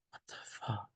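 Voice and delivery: a shocked, hushed curse under the breath; whispered disbelief, almost no voice; stunned whisper. Retold or imitated. Stunned reaction retold.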